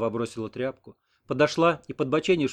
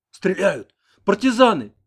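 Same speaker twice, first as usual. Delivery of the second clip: higher, louder alarmed cry; startled panic; 8 semitones higher, 9 dB louder, slower than usual. A narrator's reading. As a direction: jolt awake shouting in fright. Interpreter wakes in panic. (испуганный крик спросонья)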